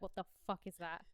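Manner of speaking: ordinary talk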